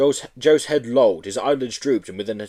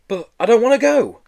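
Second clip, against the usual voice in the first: high, loud pleading protest; desperate protest; 13 semitones higher, 6 dB louder than usual. Acted. Boy clinging to the door, protesting. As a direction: beg not to go. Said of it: "Joe protested".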